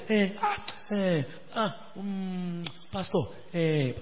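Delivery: slow, mimicked hemming and hawing; reluctant hesitation. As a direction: drag out the stalling before the pastor. Member hesitating to host sister.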